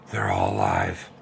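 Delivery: creepy low voice. Pretend. Child imagining toys coming alive.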